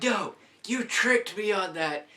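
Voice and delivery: high, loud protest at the ride operator; outraged protest. Retold or imitated. Kid yelling at the operator who tricked him, loud and high. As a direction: yell like you were just tricked. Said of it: "I'm like".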